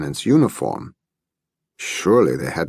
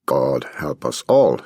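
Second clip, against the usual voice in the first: usual speaking voice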